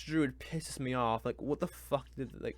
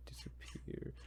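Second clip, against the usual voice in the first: whispered aside; 14 dB quieter than usual. Real, not acted. Complaining the book should vanish.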